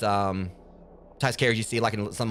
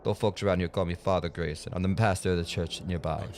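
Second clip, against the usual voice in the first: mock Jamaican accent; 5 semitones lower, 3 dB quieter than usual. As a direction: play the Jamaican pastor, half-failing. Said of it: "mock Jamaican accent".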